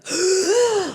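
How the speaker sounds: high startled intake of breath; a gasp of fright when the finger touched her foot; sharp frightened gasp. Retold or imitated. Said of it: "I was like".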